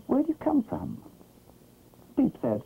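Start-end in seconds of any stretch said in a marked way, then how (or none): 0.1-1.0 high-pitched puzzled question; baffled duck, high voice. A narrator's reading. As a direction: ask it in bafflement, voice pitched right up. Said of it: "Quack was very puzzled".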